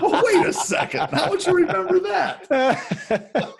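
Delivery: high, loud question through real laughter; laughing incredulity. Real, not acted. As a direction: crack up as you challenge the joke. Laughing at the amnesia joke.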